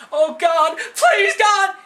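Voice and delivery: high, loud, frantic Homer Simpson begging; Homer pleading. Retold or imitated. Homer begging God for help, high loud wail. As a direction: wail it like a panicked cartoon dad. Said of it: "that episode where Homer".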